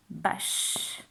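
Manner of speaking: "Bâche" as one beat ending in a long shhh, no final vowel; one syllable. Shown as a demonstration. Teacher counting spoken syllables, word kept to one beat. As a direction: let the sh of "Bâche" trail on. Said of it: "On dit pas bâche".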